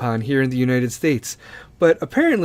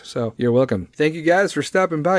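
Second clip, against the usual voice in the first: usual speaking voice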